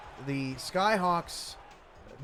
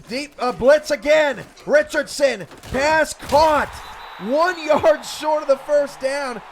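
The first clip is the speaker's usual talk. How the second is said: much higher and louder, pressing through the play; excited shouted call; 9 semitones higher, 11 dB louder than usual. Real, not acted. Announcer calls a tense late play, shouting. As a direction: ride the play at full volume. Calling a fourth-down play live.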